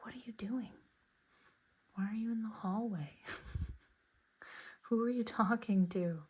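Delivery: quiet, whispered dog's thoughts; voicing the dog. Playing her curious dog asking what she's doing, quiet. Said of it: "My dog is like".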